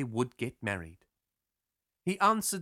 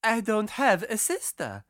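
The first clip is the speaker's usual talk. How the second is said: high, loud protest; exclaiming girl; 7 semitones higher, 5 dB louder than usual. A narrator's reading. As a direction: object loudly in a high girl's voice. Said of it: "the girl exclaimed".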